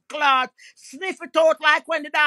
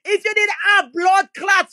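ordinary delivery

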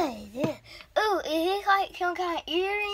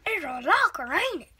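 ordinary talk

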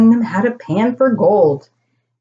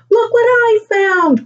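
put-on high-pitched, delighted child's call; crying out excitedly; 13 semitones higher than usual. A narrator's reading. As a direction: cry out to show off a find. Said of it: "cried out Lola".

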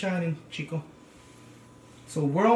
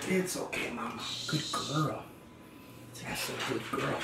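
soft soothing praise; 8 dB quieter than usual. Real, not acted. Comforting the dog softly.

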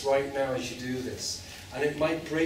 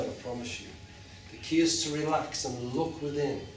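ordinary delivery

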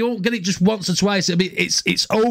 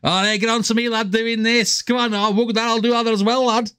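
higher-pitched, hammed-up Scouse; Scouse impression; 4 semitones higher than usual. Echoing the Scouse cartoon for viewers, high Scouse impression. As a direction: mimic a chatty Scouse lad, pitched up. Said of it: "It is so Scouse".

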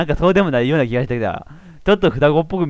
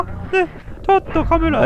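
higher, slower teasing voice; mock coaxing; slower than usual. Joking to the camera in a high coaxing voice. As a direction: tease like the character. Mock alarm at R18 scene.

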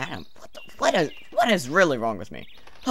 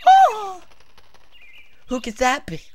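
higher, theatrical wondering; dramatic character read; 6 dB louder than usual. Acted. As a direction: read it like an overacted game line. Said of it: "What is wrong with me today?".

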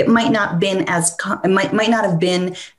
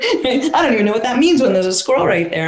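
laughing dog voice; 4 semitones higher than usual. Retold or imitated. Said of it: "the dog's like".

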